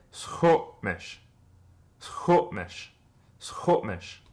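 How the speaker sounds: "Squamish" as "Skopmish" with a brief catch; slow modelling. Shown as a demonstration. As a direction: pause after the vowel of "Squamish". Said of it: "in this word".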